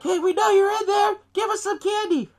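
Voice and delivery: put-on high, loud bratty kid voice; mock children yelling at a door; punk kids. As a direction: holler like pushy trick-or-treaters. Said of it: "they're being little punk asses".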